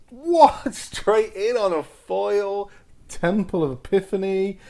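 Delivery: high, loud disbelief breaking into a laugh; real excited astonishment; amazed shout. Real, not acted. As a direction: burst out at a shock find. Surprise foil straight from pack.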